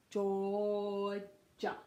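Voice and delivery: "Georgia" split into a long "jaw", then "ja"; slow teaching breakdown of "Georgia"; syllable by syllable. Shown as a demonstration. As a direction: draw out "Georgia" in two separated syllables. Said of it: "jaw, and then ja".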